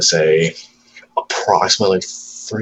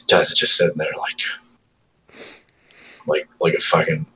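ordinary talk

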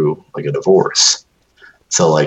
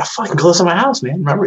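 incredulous; 11 semitones higher, faster than usual. Retold or imitated. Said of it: "I was like".

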